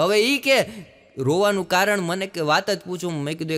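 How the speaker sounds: normal talking voice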